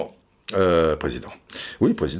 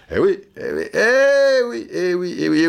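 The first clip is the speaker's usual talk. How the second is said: mock general; 9 semitones higher than usual. General voice, repeated assent.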